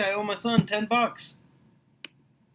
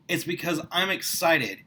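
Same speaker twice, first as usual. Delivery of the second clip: ordinary talk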